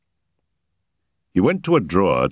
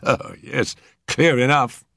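higher, pleased with himself; a self-satisfied policeman's verdict; smug constable; 7 semitones higher, slower than usual. Acted.

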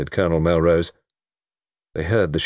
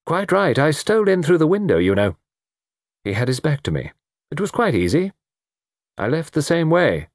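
high-pitched, easy confession; airy confessor; 9 semitones higher than usual. Acted. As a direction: tell it as if it were nothing.

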